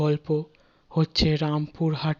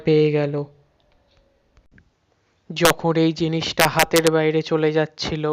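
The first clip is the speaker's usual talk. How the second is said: louder, dramatic ghost-story telling; horror story narration; 5 dB louder than usual. A narrator's reading. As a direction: build the dread of the curse.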